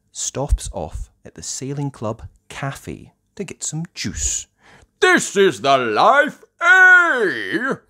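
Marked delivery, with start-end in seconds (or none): 5.0-7.7 big, loud, high character voice; Grampy Rabbit. Acted. Grampy Rabbit enjoying juice at the cafe, loud relish. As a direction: boom it out with relish. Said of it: "says Grampy Rabbit".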